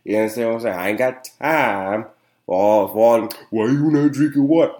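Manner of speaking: normal talking voice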